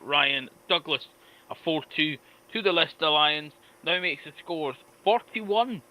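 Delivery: announcer result read. Acted. Steward announcing the heat score to the crowd, measured.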